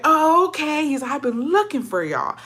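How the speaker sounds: high-pitched, loaded dad impression; imitated dad's emphatic gotcha; pointed confrontation. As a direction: sing it out pointedly, ready to confront. Dad confronts cousins he was seeking.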